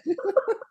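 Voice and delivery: squealing laugh; a high cry of laughter. Real, not acted. Laughing at coincidence.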